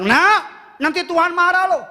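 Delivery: high, loud exasperated warning; parents warning child. Retold or imitated. Parents warning their fidgeting child at church, loud exasperated threat. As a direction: snap the warning at the fidgeting kid. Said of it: "Papa mamanya bilang".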